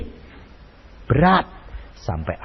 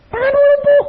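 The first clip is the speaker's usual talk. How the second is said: very high, loud shocked cry; shocked question; 8 dB louder than usual. Retold or imitated. Husband hearing wife's hand is paralysed, shocked cry. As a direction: gasp it out in disbelief.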